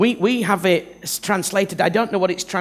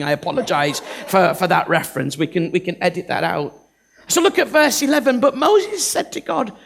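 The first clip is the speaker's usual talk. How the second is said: ordinary talk, nothing marked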